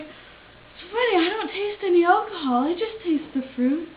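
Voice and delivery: almost crying. Retold or imitated. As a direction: imitate a tipsy guest near tears. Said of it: "I started to, like, cry almost".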